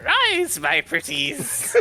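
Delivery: high, drawn-out cartoon witch cackle voice; Disney witch voice. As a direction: crow it like a gleeful storybook witch. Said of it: "Summoning Skeleton's like".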